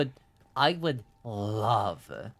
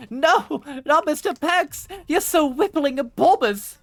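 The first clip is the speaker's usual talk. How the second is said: very high, loud hammed-up cry; mock dismayed wail; 8 dB louder than usual. Streamer pretending horror at Mister Pex, hammed-up wail.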